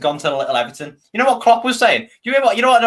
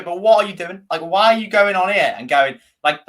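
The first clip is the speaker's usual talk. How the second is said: usual speaking voice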